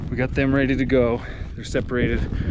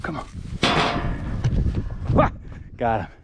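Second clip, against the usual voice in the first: breathless after chasing cows; panting between words; out of breath; faster than usual. Real, not acted. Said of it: "Sorry, I'm out of breath".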